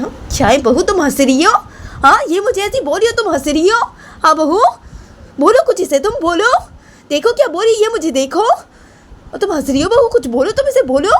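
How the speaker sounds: very high, heated scolding; outraged grandmother. Acted. Grandmother scolds daughter-in-law for laughing, outraged. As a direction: demand support in a high-pitched, indignant voice.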